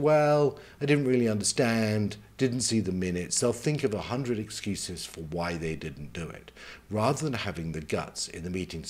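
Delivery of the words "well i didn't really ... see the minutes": higher, louder mock protest easing off; passive-aggressive excuse. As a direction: imitate a colleague wriggling out of a task. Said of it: "the passive-aggressive person".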